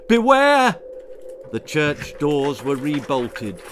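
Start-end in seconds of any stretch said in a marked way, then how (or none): 0.1-0.8 very high, loud shout; cry of warning. A narrator's reading. Thor cries out to his people, alarmed shout. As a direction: yell the warning. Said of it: "just as he cried out".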